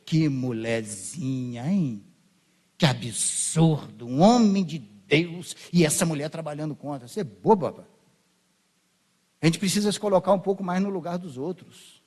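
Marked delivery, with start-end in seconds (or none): 0.1-7.9 lower, slow, mocking disdain; mock scorn. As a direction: sneer at her like a self-righteous judge. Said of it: "Aí a gente faz o quê?". (desdém zombeteiro, voz mais grave e lenta)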